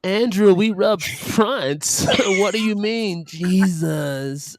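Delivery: high, drawn-out cartoon voice; high-pitched exaggerated character impression; Lola Scumpy. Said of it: "You got fucking Lola Scumpy".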